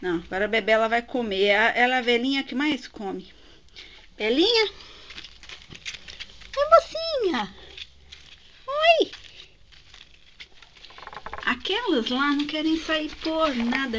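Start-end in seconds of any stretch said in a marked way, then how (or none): 4.2-9.1 high sing-song greeting to the ferret. Real, not acted. Owner greeting her old ferret in high baby talk. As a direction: coo at her sweetly, pitched way up.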